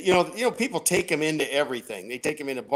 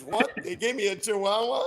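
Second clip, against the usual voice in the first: high, startled disbelief; shocked punchline; 6 semitones higher than usual. Acted. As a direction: act stunned at the news. Blind man's shocked punchline.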